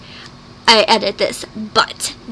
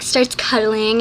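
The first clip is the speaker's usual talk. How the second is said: ordinary talk, nothing marked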